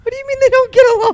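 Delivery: high, fast, disbelieving question; can't believe what she just heard; incredulous. Real, not acted. Incredulous reaction to the claim.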